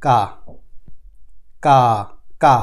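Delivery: "কা" drilled as a long open "kaa"; German letter name "কা", drawn-out ah. Shown as a demonstration. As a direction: repeat "কা" slowly, spaced out for learners. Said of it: "আর কে এর উচ্চারণ".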